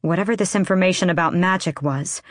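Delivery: ordinary delivery, nothing marked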